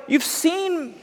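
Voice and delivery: high-pitched pleading, lightly acted; begging father. Father coaxing his older son back inside, begging. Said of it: "he begged him".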